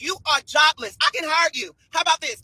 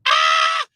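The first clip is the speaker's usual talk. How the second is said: sharp high shriek; 4 dB louder than usual. Real, not acted. Reacting to a burn.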